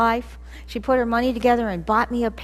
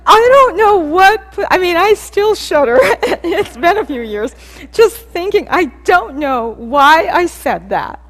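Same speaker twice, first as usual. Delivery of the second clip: cringing laugh; 6 semitones higher, 12 dB louder than usual. Real, not acted. Still cringing at the memory.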